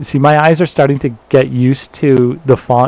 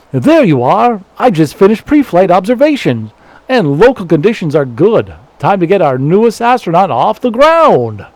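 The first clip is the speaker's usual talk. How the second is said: higher, lively swooping voice; upbeat launch-site character; 5 semitones higher than usual. Acted. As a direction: read it as a game character sending off an astronaut. Reads game character's dialogue.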